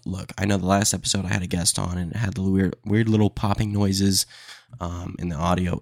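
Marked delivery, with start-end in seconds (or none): none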